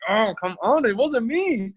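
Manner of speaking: a hammed-up wounded denial; frustrated protest. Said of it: "I'm just getting super frustrated".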